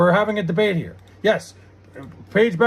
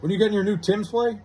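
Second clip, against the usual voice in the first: ordinary delivery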